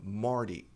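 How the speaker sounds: "Morty" with a tapped T, like "mordy"; name "Morty" with an American flapped T. Shown as a demonstration. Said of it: "Nome próprio".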